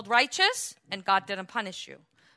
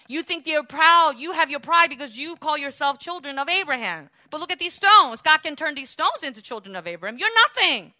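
loud, higher furious rebuke; angry yelling; 7 semitones higher, 9 dB louder than usual. Retold or imitated. John yelling at the Pharisees in line for baptism, angry. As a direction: yell it at them, cursing. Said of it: "he's yelling at them and cursing".

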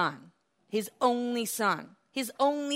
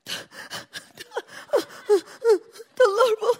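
mock shrill high panic, much higher and louder; panicky squeak; 8 dB louder than usual. Son's mock panic at father's words, squealed high. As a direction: ham up a frightened squeal. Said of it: "He didn't go like".

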